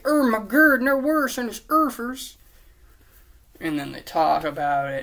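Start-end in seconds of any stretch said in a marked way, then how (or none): 0.0-2.4 high, loud, garbled silly voice; goofy mock reaction. Friends reacting to Harry's news, goofy high voice. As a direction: pretend shock in a cartoonish voice. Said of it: "And they're like".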